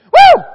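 high, loud whoop of excitement; sudden loud celebratory whoop; excited whoop. Real, not acted. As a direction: let out a joyful whoop.

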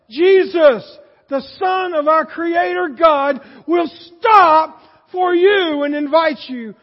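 loud, rising preacher's cry; full-force pulpit shout; preaching shout. Real, not acted. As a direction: build it up and shout it to the congregation. Preacher building to a climax.

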